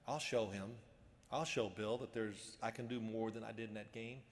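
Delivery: low, softened retold resolve; brooding vow. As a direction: mutter a grudge to yourself. Retold brooding vow driving home.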